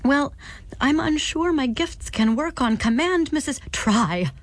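put-on flustered girl searching for words; nervous and alarmed.

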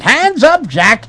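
high-pitched hammed-up command; theatrical stick-up call; cried out. A narrator's reading. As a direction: cry it out like a pulp detective. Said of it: "he cried".

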